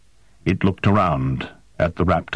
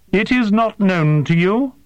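higher, stiff and formal alien voice; alien interpreter; 12 semitones higher than usual. A narrator's reading. Interpreter questions the human, high and formal. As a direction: ask with precise, careful, unhuman politeness.

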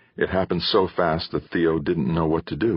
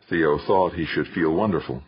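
plain reading voice

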